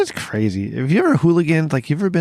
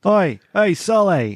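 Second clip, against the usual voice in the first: put-on Boston accent; 7 semitones higher, much slower than usual. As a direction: shout it like a Southie guy hailing a buddy. Boston guy impression.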